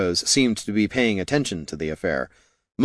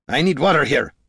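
ordering; 6 semitones higher, 3 dB louder than usual. A narrator's reading. Dwarf orders foreign goblin.

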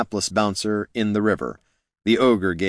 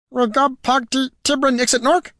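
very high, squeaky goblin chatter; tiny goblin jabbering, pitched way up. A narrator's reading. Goblin speaks his own tongue.

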